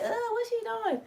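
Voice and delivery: high squeaky mock-viewer voice; teasing imitation of a judgy viewer; mock nosy viewer. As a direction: pitch it way up like a snooping onlooker. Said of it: "So don't try to be like".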